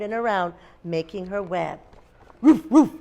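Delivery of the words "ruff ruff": two short barks; dog bark. A narrator's reading. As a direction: imitate a dog woofing for children. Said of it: "said the dog".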